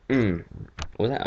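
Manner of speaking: normal talking voice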